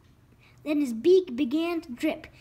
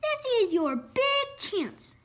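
eager; 7 semitones higher than usual. A narrator's reading. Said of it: "said Father".

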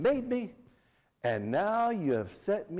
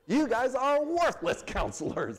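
slower, higher, half-laughing scorn; amused mock outrage; mock indignant with a laugh; 9 semitones higher than usual. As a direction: relish the insult, chuckling through it.

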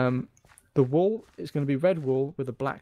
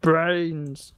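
zombie voice; 5 dB louder than usual. Pretend. Imitating zombie.

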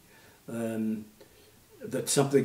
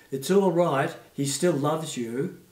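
put-on raised, slower comforting child voice; a little kid reassuring gently; child from audience; 5 semitones higher, 6 dB louder, slower than usual. Child in the audience comforting the boy, lightly voiced. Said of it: "a little voice came from the audience".

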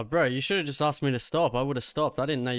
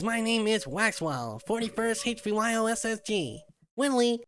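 put-on nerd accent; 8 semitones higher than usual. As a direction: lisp it like a proud nerd. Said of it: "You keep doing that accent".

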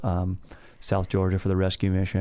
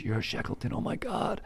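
awed gasp; 3 semitones higher, 7 dB quieter than usual. Retold or imitated. Whaler's awed recognition.

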